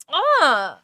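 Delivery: ordinary talk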